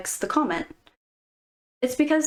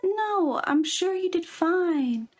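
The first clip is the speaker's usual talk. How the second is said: higher, slow, fake-sweet friend; mock reassurance; 7 semitones higher, slower than usual. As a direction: coo it slowly like a pretend-nice friend.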